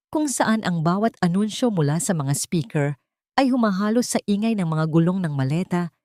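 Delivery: plain reading voice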